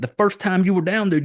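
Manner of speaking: usual speaking voice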